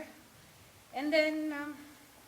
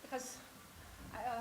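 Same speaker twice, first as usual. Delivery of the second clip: usual speaking voice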